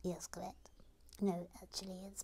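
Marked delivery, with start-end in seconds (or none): 0.0-0.7 whispered character voice. Acted. Puppet character confirms.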